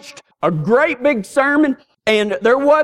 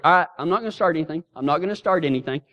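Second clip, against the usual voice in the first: fast, softer grumbled promise; quick placating mutter; 6 semitones lower, 6 dB quieter, much faster than usual. Retold or imitated. Young man backing down for his girlfriend, fast and muttered. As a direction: rush it out to appease her.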